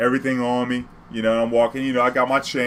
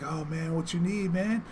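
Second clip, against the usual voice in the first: much higher and softer wheedling; pushy dealer; 6 semitones higher, 8 dB quieter, slower than usual. Retold or imitated. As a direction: coax like a dealer not giving up. Dealer pleading, voiced high.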